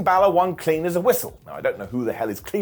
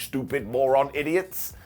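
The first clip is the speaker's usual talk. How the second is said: mock insult; 2 semitones lower, 3 dB quieter, slower than usual. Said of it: "were like".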